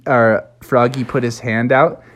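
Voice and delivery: ordinary delivery, nothing marked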